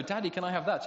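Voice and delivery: imitating a seven-year-old boy. A boy asks his dad for a toy, in a high, loud child's voice. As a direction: play the little boy begging for a treat in the supermarket. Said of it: "they will go".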